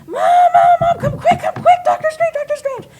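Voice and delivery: very high, loud, frantic kid impression; imitated child screaming. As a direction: scream like a starstruck little boy calling his mother. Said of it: "And he started screaming".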